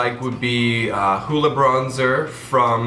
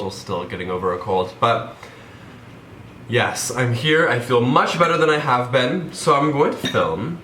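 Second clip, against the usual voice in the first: ordinary talk, nothing marked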